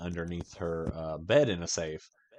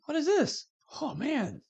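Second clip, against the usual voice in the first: curious surprise; 4 dB quieter than usual. Retold or imitated. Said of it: "I was like".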